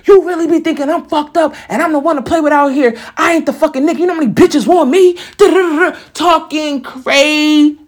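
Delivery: high-pitched mimicry of an angry man; mock his in-your-face boasting; mocking imitated rant. Man ranting right in her face, mocking imitated rant.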